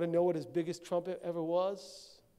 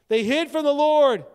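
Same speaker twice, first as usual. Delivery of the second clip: loud, higher cry of the line; preached shout; 5 semitones higher, 9 dB louder than usual. Real, not acted. Preacher crying out to the church, loud and high. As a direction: hit it hard from the pulpit.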